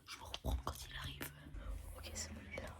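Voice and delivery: hushed whisper. Real, not acted. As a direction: whisper so he won't hear. Hiding whisper. (chuchotement pour ne pas être entendu)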